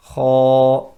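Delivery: Kha loud and full, rising; heavy Kha. Shown as a demonstration. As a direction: sound of Kha lifted to the palate. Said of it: "that sound rising upwards".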